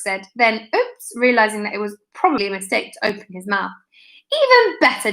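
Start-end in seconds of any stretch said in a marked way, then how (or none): 4.4-5.1 a higher, bright grin in the voice; gleeful. A narrator's reading. Joe seizing the headmaster's slip: gleeful, much higher. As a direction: pounce on it with delight. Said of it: "Joe grinned".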